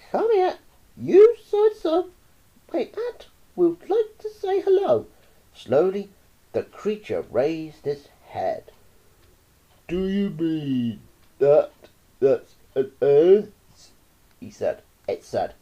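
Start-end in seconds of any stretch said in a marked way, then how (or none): 0.1-5.1 put-on high, loud boy's shout. Roy shouts at the starving anteater, bratty. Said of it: "Roy yelled". 9.9-13.5 slow, pleading creature voice; anteater's plea. Acted. Starving anteater begs Roy for food, pleading.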